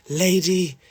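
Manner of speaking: lightly put-on period-drama English accent; a touch of costume-drama English. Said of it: "like, a period drama".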